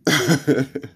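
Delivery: short gloating cackle; mocking laugh. Real, not acted. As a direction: laugh at them, amused. Laughs after taunt.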